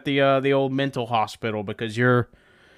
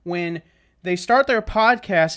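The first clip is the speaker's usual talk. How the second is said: ordinary talk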